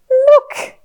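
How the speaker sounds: put-on high, loud "look" to a toddler; excited pointing out. Shown as a demonstration. Showing a little one the picture, excited. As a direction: call out a delighted discovery.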